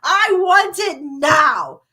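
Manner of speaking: loud, slow, high bratty shout; spoiled brat demand. Retold or imitated. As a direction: stretch out the demand like a stamping child. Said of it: "that's who I felt like I was".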